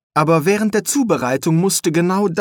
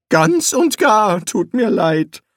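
higher, slow, sheepish voice; apologetic wizard; 7 semitones higher, slower than usual. A narrator's reading. Rincewind admitting to Eric he lacks power, sheepish. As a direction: sound sorry and useless.

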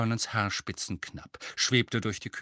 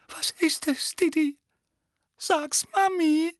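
very high doting mummy voice; high, drawn-out cooing; coaxing mother; 4 dB louder than usual. Acted. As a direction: coax your little darling to speak. Mother coaxing her darling son.

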